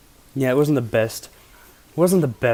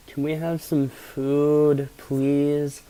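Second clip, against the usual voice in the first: higher, slow pleading request; 4 semitones higher, much slower than usual. Retold or imitated. Stoner begging drive-thru worker for food, high and pleading.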